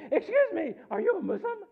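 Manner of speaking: high, loud squeal of a woman; hammed-up excited woman; mock eager call. Acts eager woman calling from car.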